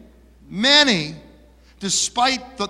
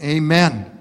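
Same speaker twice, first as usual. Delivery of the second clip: usual speaking voice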